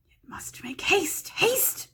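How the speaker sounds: high hissing whisper of a hungry creature; Gollum voice. Acted. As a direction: whisper it greedily, like a slimy cave creature. Gollum voice in read story.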